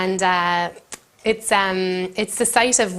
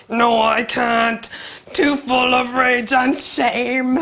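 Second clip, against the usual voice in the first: slow, hammed-up dramatic refusal; mock rage and shame; 4 semitones higher, much slower than usual. [name] refusing the teacher to keep reading, slow mock despair. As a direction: drag it out like a wounded schoolgirl. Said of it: "I was like".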